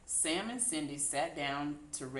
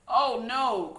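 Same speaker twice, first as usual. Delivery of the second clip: louder dismayed cry; cried out; 6 semitones higher, 9 dB louder than usual. A narrator's reading. Sam seeing pigs in the garden, crying out. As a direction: blurt it out in alarm. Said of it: "cried Sam".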